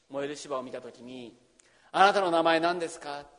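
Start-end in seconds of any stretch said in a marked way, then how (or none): 1.9-3.3 "あなたの名前何ですか？" called out louder, stress on あ, falling end; earnest loud question. Acted. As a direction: ask it boldly, raising the voice. Moses asks God's name.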